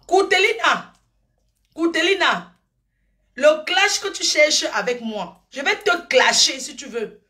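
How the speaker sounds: high, loud challenge to a rival; loud call-out. Real, not acted. Challenging rival Coutelina on live, loud and high. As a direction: call her out loudly, picking a fight.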